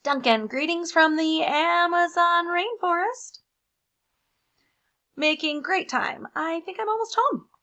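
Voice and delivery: high, loud, chipper voice; cheery lost crayon. Acted. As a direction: sound proudly clueless.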